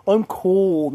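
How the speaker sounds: drawn-out mock whine about being tired; mock complaining. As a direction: imitate worn-out friends griping, dragging the words.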